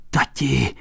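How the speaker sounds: put-on soft, hushed child's call; whispered. A narrator's reading. Boy to his father in the cellar, whispered. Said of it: "špitl chlapec".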